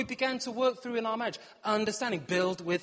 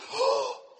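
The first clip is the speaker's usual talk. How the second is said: mock gasp; 3 dB louder than usual.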